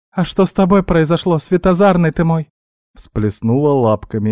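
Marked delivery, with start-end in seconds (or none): 0.1-2.5 high, squeaky teasing concern; exclaiming guinea pig. A narrator's reading. Guinea pig asking what happened to him, squeaky fussing.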